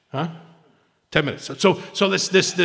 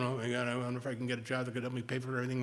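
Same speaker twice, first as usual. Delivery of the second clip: fast, quiet worried rush of thought; racing thought; 7 dB quieter, much faster than usual. Retold or imitated. Restless meditator caught in money worries, fast and quiet. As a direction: rattle off the anxious thought quickly. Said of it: "people ... they're going".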